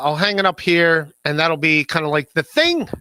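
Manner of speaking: ordinary talk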